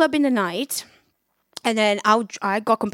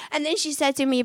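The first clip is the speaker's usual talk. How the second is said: ordinary delivery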